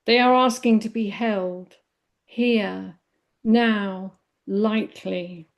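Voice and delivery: measured poem reading in short, separated phrases. Real, not acted. Meditation teacher reading a poem on healing to listeners, poem reading. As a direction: let each phrase land, with lifts on key words. I'm going to read a poem.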